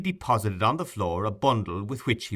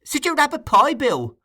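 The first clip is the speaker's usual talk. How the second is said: put-on much higher, louder boyish cry; Charley Bates showing off the pie, high and loud; exclaimed; 7 dB louder than usual. A narrator's reading. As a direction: show off the pie with glee. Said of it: "exclaimed that young gentleman".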